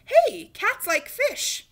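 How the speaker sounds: high, loud, slow exclamation; boy suddenly remembering; excited idea. A narrator's reading. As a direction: shout out the idea. Sudden realization.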